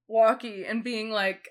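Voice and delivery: ordinary talk, nothing marked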